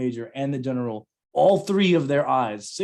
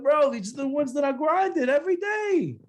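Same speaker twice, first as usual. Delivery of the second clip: whiny defense. Retold or imitated. His own whiny defense.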